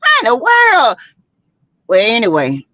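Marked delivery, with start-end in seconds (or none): 0.0-1.0 high exasperated outburst; incredulous cry. Real, not acted. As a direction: throw the question up high in disbelief. Exasperated outcry.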